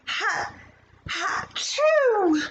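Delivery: high sneeze built from hat, hat, chew. Acted. Jenny sneezing over her drawings, high and sharp. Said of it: "Jenny sneezed".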